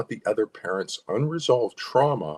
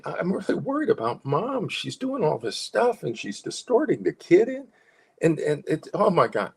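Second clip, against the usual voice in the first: higher, smiling, worried concern; 10 semitones higher than usual. Shown as a demonstration. Parent telling a psychologist about mom, worried and smiling. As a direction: voice real worry with a smile. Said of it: "And now you can be assertive and say".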